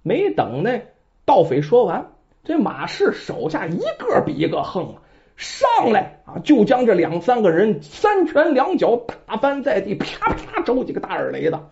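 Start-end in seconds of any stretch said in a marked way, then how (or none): none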